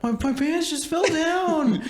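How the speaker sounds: very high, slow squeaky excuse; high-pitched parody, slowly pleading; mock video voice. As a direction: stretch it out high like the kid in the clip. Quoting a video they love.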